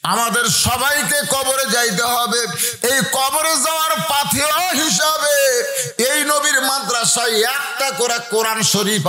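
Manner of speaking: high, ringing chanted exhortation; impassioned sermon cadence; preaching appeal. Real, not acted.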